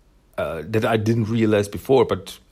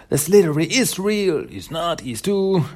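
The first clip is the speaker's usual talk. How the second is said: insistent shout; 7 semitones higher than usual. Acted. Kid insists against mocking kids.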